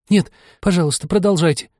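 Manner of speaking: high-pitched humble plea. A narrator's reading. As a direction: beg meekly, voice lifted. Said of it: "сказала Аня очень смиренно".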